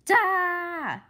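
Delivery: high, loud sound-effect shout; loud dramatic cry. A narrator's reading. Narrator voicing a scare sound, loud. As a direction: belt it out like a scare chord.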